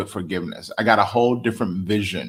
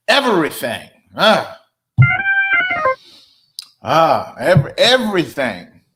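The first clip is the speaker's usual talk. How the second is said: preaching; 6 semitones higher than usual. Acted. Preaching at full force.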